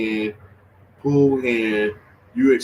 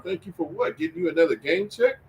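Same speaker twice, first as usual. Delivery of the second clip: high-pitched incredulous scoffing; amused ridicule in a raised pitch; mocking disbelief; 6 semitones higher than usual. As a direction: throw the questions back up high, laughing by the end. Scoffs at player's thanking Draymond.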